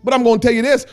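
normal talking voice

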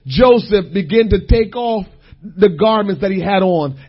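normal talking voice

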